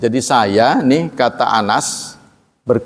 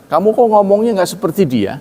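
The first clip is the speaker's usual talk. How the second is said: Aisyah rebuking; 8 semitones higher, faster than usual. Retold or imitated. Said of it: "Kata Aisyah".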